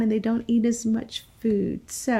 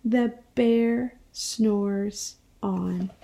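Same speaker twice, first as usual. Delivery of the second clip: slow and sleepy; sleepy storybook refrain; drowsy refrain; 3 semitones lower, much slower than usual. Acted. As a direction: stretch it out like a yawn. Refrain of the picture book.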